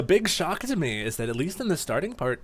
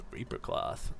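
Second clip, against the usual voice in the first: breathless whisper of recognition; whispered surprise; 10 dB quieter, slower than usual. Acted. Duskull spotting his lost cloth, stunned whisper. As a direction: hush it like you can't believe it.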